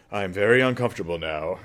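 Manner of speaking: a hint of stiff discomfort; awkward aside. Listener squirming at someone's weeping, lightly awkward. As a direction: say it like you want to leave. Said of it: "And you're like".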